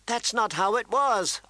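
high, tearful protest; Arya, close to tears. A narrator's reading. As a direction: protest on the edge of crying. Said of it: "close to tears".

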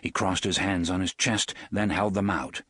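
usual speaking voice

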